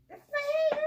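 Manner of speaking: put-on very high, loud squeaky child reply; mock child voice, high and loud. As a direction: squeak it like a surprised little kid. Voicing daughter's surprised reply.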